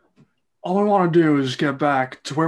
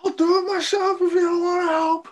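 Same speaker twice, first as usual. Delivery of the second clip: high, whiny mimicry of his brother's line; mocking imitation. Mario mocking Luigi's line back at him, high and whiny. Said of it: "Mario, mimicking him".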